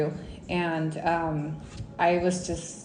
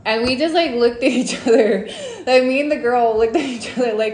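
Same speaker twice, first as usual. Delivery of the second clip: breaks into laughter while telling it, louder; giggly, louder retelling; laughing; 5 semitones higher, 7 dB louder than usual. Real, not acted. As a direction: laugh through the words.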